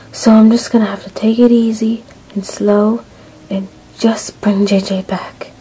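usual speaking voice

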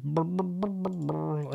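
soft repeated "brr" noises; idly burble brr-brr-brr under your breath; lip-trilled filler noise. Real, not acted. Host looking for the next comment, soft burbling filler noise.

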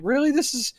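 high, disbelieving rhetorical question; incredulous. Retold or imitated. Reviewer mocking the finale, high disbelief. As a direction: scoff at the ending. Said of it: "And I'm like".

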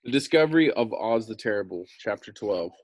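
plain reading voice

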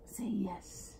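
whispered coaxing; soft voiceless prompt. Real, not acted. Coaxing baby to talk.

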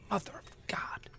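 whispered shock at a price; awed whisper. Real, not acted. Gamer sees the price, whispers in awe. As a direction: breathe it out in disbelief.

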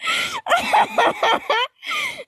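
long, very high giggling fit; high cackling laugh. Real, not acted. Guest cracks up at host's gassy question, high cackle. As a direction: burst into squealing laughter.

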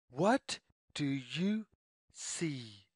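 ordinary delivery, nothing marked

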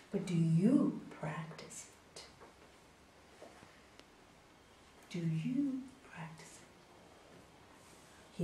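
whispered, slow pointed question; soft whisper. Acted. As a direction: whisper it slowly so it sinks in. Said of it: "looks at him and says".

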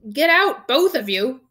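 high, loud strained order; croaked order. A narrator's reading. Uncle orders both boys out, croaking. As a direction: croak out a dismissal. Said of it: "croaked Uncle Vernon".

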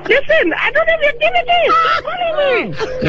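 strained high-pitched plea; squeaky high voice. Real, not acted. Caller asks why his voice went up, high pitched plea. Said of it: "Why your voice?".

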